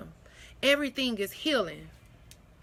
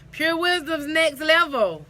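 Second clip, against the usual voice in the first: proud announcement; 6 semitones higher, 7 dB louder than usual. Real, not acted. Proclaiming brand.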